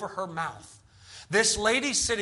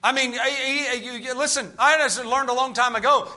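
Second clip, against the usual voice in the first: normal talking voice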